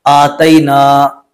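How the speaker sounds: आतैना slow, with the "ai" vowel; wrong pronunciation. Shown as a demonstration. Teacher imitates how people misread आतैना. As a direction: stretch आतैना as the mistake. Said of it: "वो पढ़ते क्या बहुत सारे लोग".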